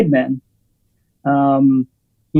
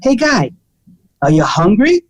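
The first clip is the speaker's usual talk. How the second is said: mock gruff waiter, first words pitched up; pushy waiter; 4 semitones higher than usual. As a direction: needle the shy customer like a blunt waiter. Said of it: "Really super aggressive".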